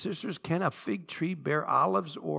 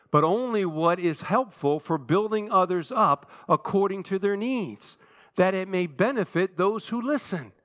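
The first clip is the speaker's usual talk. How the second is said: raised, measured reading for a congregation; scripture recitation; 6 semitones higher, 4 dB louder than usual. Real, not acted. Preacher leading church in reading scripture aloud, measured recitation. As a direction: lead the room through the verse, projecting.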